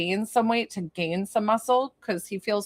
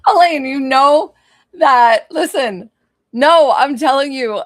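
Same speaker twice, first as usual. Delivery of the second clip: higher and louder, still laughing through the words; laughing while talking; 6 semitones higher, 10 dB louder than usual. Real, not acted. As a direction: talk through real giggles. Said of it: "I'm laughing so hard".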